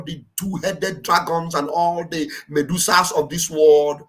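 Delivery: slow, low-pitched declamation; measured, solemn oration; rallying prophetic speech. Acted. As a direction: proclaim it like an epic prophecy.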